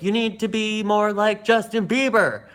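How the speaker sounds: hammed-up bossy teacher imitation; imitating dance teacher. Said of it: "[name] was like".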